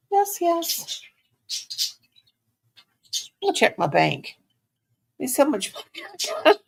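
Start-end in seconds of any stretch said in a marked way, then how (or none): 0.1-1.0 cooing yes. Real, not acted. Cooing to the dog.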